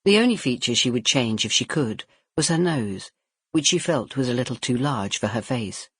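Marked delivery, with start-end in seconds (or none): none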